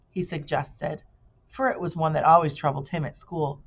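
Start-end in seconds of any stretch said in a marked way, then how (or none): none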